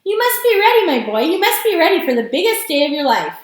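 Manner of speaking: worked-up, emphatic urging; excited shout. A narrator's reading. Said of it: "shouted Grandpa Joe".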